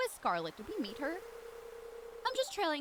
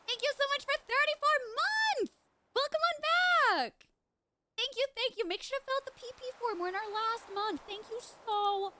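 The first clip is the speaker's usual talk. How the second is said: much higher, bright streamer thanks; excited thanks; 11 semitones higher, 3 dB louder than usual. Real, not acted. Thanking a subscriber on stream, gushing. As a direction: gush over the sub.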